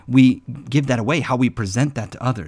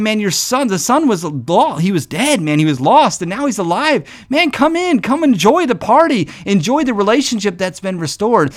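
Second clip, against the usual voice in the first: higher, coaxing and joyful; pleading father; 7 semitones higher than usual. Retold or imitated. Father urging the older son to come in, joyful and high. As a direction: draw him in to the party.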